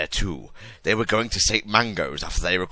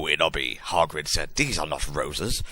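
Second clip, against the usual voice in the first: plain reading voice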